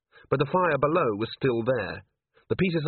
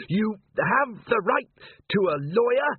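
Carrot reciting rights; 10 semitones higher than usual. A narrator's reading. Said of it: "Angua heard him saying".

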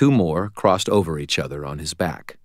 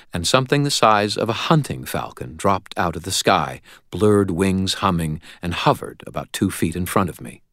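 ordinary delivery

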